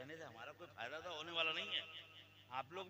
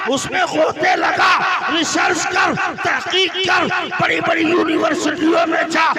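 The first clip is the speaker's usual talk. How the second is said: booming high shouted sermon; fiery preaching; 30 dB louder, slower than usual. Acted. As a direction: preach it at full force, slowly at times. Preaching at full force.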